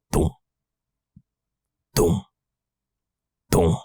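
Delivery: one low beat, then a long gap; single heartbeat thuds. Retold or imitated. Teller hears his heart lose the second thump, spaced single thuds. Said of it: "not hearing the second thump".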